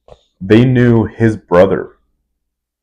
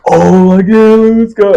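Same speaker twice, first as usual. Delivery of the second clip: mock hyped partier, much higher; hyped invite; 12 semitones higher than usual. Older partiers hyping up the kid, excited. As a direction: squeal it like an eager party guy.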